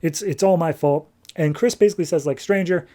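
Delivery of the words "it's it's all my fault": ordinary delivery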